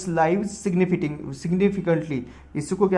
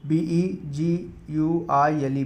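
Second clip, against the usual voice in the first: slow spelling; much slower than usual. Shown as a demonstration. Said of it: "spelling word slowly".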